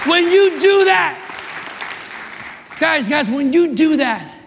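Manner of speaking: high, emphatic preacher's charge; fired-up sermon delivery; full-force preaching. Real, not acted.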